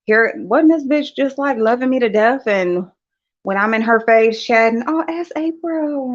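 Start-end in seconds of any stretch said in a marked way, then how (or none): 4.9-6.1 high, drawn-out mimicry; mocking imitation. Mimicking her chatter in someone's chat, mocking. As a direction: stretch it out high in mockery.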